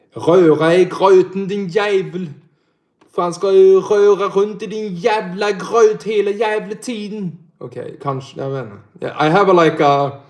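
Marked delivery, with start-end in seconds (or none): 0.1-7.4 higher, put-on Scanian accent with a Danish lilt; mock Skåne accent. Shown as a demonstration. Streamer mocking the Skåne dialect for chat. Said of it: "Testa skånska".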